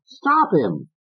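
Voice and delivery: high insistent order, repeated; Fats. Acted. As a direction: hammer it again.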